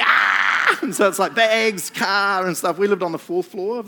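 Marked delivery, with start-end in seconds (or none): 0.0-0.8 panicked yelp. Retold or imitated. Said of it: "it was like".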